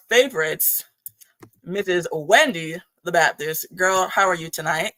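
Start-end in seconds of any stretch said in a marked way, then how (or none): none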